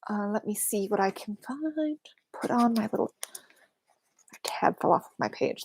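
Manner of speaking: whispering. Real, not acted. Muttering to self searching desk.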